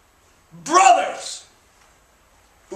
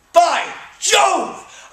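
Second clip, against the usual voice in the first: theatrical outburst; 5 semitones higher than usual. Acted. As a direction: burst out with the oath. Sudden outburst in speech.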